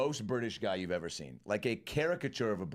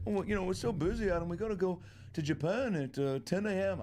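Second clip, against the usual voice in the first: much higher, breezy thanks; mock rock star signing off, sing-song; 9 semitones higher than usual. Quoting [name] declining.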